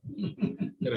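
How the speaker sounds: short, soft chuckle; amused chuckle. Real, not acted. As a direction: chuckle quietly at your own example. Amused by his friend Shiva example.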